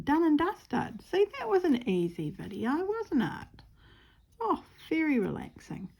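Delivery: high ironic sing-song; sarcastic. Real, not acted. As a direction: chirp it ironically.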